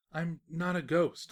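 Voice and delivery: ordinary delivery, nothing marked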